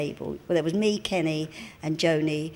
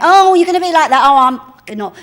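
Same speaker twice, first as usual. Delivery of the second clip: mock sulky protest, louder and higher; petulant huff; 8 semitones higher, 14 dB louder than usual. Kenny sulks at the speaker after being told off, loud and petulant. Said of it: "then he went".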